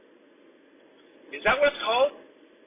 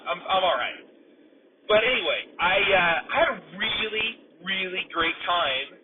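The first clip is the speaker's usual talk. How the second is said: ordinary talk, nothing marked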